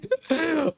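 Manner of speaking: high short laugh; quick high chuckle. Real, not acted. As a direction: laugh off the bad omen. Laughs at poor season.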